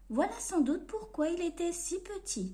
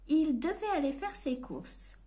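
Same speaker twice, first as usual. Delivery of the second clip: ordinary delivery, nothing marked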